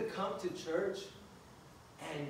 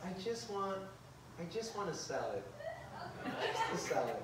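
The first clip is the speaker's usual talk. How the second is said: dainty salad order; 9 dB quieter than usual. Retold or imitated. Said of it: "and she goes".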